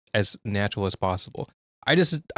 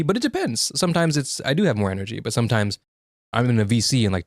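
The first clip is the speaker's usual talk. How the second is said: normal talking voice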